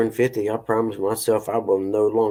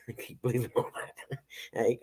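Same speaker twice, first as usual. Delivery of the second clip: whispered aside; 2 semitones higher, 10 dB quieter than usual. Real, not acted. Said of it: "whisper aside".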